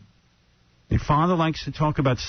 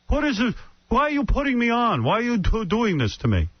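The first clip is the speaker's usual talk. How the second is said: higher, suspicious retort; defensive father snapping back; father rebuffing his son's question; dad's suspicion; 9 semitones higher than usual. Retold or imitated. Dad snapping at son, retold.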